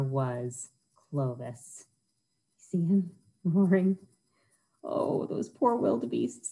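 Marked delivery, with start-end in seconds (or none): none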